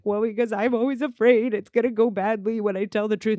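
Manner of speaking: mock-tearful voice for him; imitated tearful confession. Admitting to her he fears the truth, tearful imitation. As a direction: confess it as if starting to cry. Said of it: "Now he's crying".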